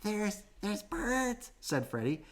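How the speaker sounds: put-on high, soft childlike voice; small joey pointing out birds; little Freddy voice. A narrator's reading. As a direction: chirp up eagerly. Baby kangaroo speaking.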